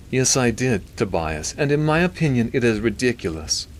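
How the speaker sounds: high nasally would-be British doctor; Milton. A narrator's reading. Doctor judging a movie for his priest friend, nasally. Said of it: "He had a nasally voice".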